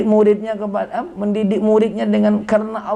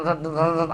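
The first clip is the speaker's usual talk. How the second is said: low rolled-r vibration noise; short low buzzing bursts; imitated phone buzz; 4 semitones lower, 7 dB quieter than usual. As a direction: trill "rrrt" like a vibrating phone. Imitates phone buzzing during study.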